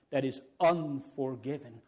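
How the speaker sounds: usual speaking voice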